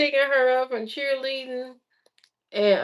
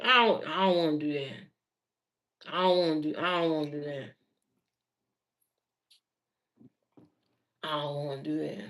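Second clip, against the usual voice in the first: put-on softer, fading child refusal; a hint of a reluctant child; 8 semitones lower, 7 dB quieter, faster than usual. Mother imitating son refusing football, softly. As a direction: quietly mimic a kid who won't play.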